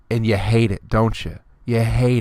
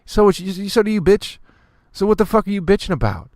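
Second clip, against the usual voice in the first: higher, louder outburst; exasperated rant; 10 semitones higher, 3 dB louder than usual. Acted. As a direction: throw it back at them, fed up. Ranting at complainers.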